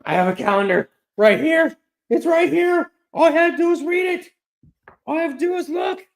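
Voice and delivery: very high, loud self-scolding; exasperated outburst. Real, not acted. Streamer berating himself over a misread calendar, exasperated shriek. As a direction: shriek in frustration at your own mistake.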